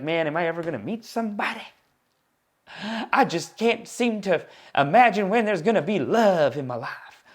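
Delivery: lightly voiced Southern guy, lonely and longing. Comedian voicing a lonely Southern guy in a light Southern accent. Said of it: "like that hick, that, sorry, not hick, Southern character".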